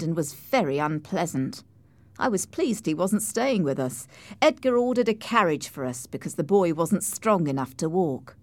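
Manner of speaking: usual speaking voice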